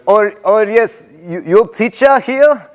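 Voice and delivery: put-on high, halting old European immigrant accent. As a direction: imitate an old European sub with broken English. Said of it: "That's an accent I know".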